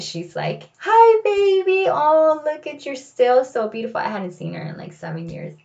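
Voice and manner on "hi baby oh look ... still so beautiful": softened grandmother. Retold or imitated. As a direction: coo at her granddaughter. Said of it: "she softens".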